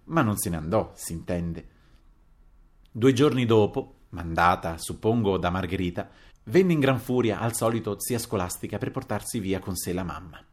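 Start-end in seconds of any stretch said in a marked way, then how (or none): none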